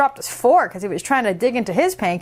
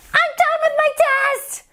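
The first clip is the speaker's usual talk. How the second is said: put-on high, fast chatty little girl; 14 semitones higher than usual. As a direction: rattle it off like an excited kid. Decibella voice.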